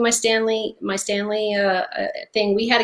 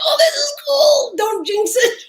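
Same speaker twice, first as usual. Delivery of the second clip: much higher, loud, giddy whisper-shout of delight; thrilled girls; 5 dB louder than usual. Retold or imitated. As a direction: voice the daughters barely containing glee. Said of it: "They're just like".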